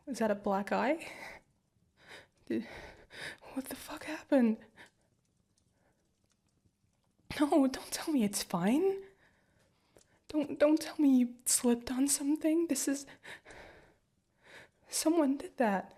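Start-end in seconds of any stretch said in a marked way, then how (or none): 2.5-13.1 higher, rising alarm and anger; alarmed, angry partner; upset outburst. Acted. Discovers bruise, gets upset. 14.9-15.9 higher and slow, stunned anger; high, slow, stunned "someone"; alarmed realisation. Acted. Repeats realisation, first take.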